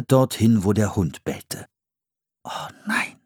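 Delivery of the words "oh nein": erschrockenes Flüstern; geflüstert. A narrator's reading. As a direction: leise Panik, kaum hörbar. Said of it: "flüsterte Philipp". (Philipp flüstert entsetzt)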